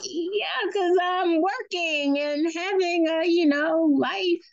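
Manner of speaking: high, drawn-out sarcastic comeback; mock defensive retort. Mother answering her son's complaint about unwatched shows, high drawn-out sarcastic retort. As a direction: stretch it out high, as if stating the obvious.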